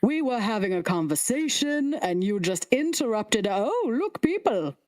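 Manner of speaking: high, prissy put-out voice of a talking head; a high, offended head on a stick; snooty severed head. Acted. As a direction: sniff at the rude interruption, high and huffy. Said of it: "the other one's like".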